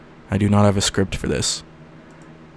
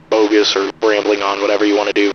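lo-fi radio; faster than usual. Shown as a demonstration. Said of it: "lowering the project rate".

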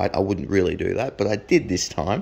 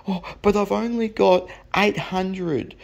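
high, drawn-out mock plea; imitated sorry haggling, pitched up; pleading poverty; 9 semitones higher, slower than usual. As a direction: plead slowly like a hard-up buyer.

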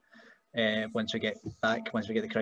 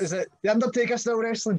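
much higher and louder mock old-timer; old-timer fan; 11 semitones higher, 6 dB louder than usual. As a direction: ham up the out-of-touch uncle. Mocking an old fan's question.

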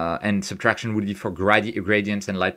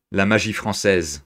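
put-on louder, theatrical French accent; French spoken with hammed-up flair; mock French accent; 4 dB louder than usual. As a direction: announce it proudly in a French accent. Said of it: "outrageous French accent going forward".

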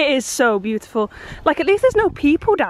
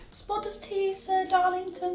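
higher, softer, prim servant voice; mock posh maid; 7 semitones higher, 10 dB quieter, slower than usual. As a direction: offer tea like a timid maid. Posh servant roleplay begins.

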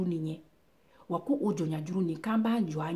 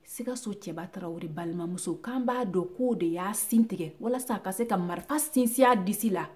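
normal talking voice

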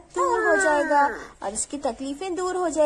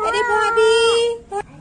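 drawn-out call; 5 semitones higher, 7 dB louder, much slower than usual. Acted. Drawn-out call to sister-in-law.

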